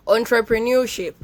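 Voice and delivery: pause, then deliver "entrepreneurship" with an on sound; correct pronunciation. Shown as a demonstration. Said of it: "It is pronounced as".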